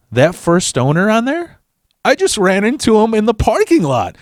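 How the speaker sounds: high, sing-out bluffing claim; feigned innocence. Retold or imitated. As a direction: sell the lie in a high voice. Lying to the clerk.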